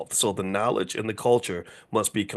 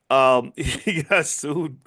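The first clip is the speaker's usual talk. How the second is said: slow, higher, breaking into a laugh; 5 semitones higher, 4 dB louder, much slower than usual. Real, not acted. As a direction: try to say it through real laughter. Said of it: "I'm gonna stop laughing".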